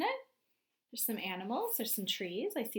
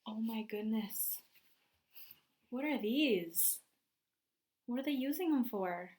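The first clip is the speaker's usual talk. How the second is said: usual speaking voice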